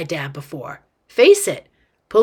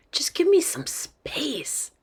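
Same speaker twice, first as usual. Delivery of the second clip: slow, hushed, higher-pitched plea; exasperated whisper; 7 semitones higher, 6 dB quieter, slower than usual. A narrator's reading. Boy confides his wish to be left alone, exasperated whisper.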